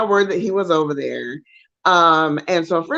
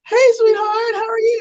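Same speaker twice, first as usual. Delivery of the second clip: Steve's friendly greeting; 14 semitones higher than usual. Retold or imitated.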